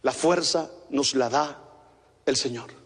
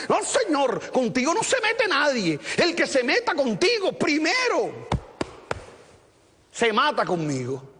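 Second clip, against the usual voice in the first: raised-pitch cocky boast, lightly acted; boastful Peter; 7 semitones higher than usual. Peter swearing to protect Jesus, cocky boast. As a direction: puff up like a fighting rooster. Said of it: "sonó como gallito de pelea".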